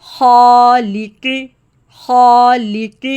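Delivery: خالق slow, alif full and heavy after خا. Shown as a demonstration. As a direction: draw out خالق with a thick alif. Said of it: "الف کی sound heavy ہوگی". (خالق میں الف موٹا اور لمبا)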